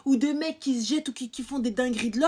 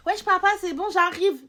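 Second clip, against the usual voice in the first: higher, louder brush-off; impatient mock reply; 4 semitones higher, 6 dB louder than usual. Daughter telling her father she's coming, impatient. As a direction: play a daughter fobbing off her dad.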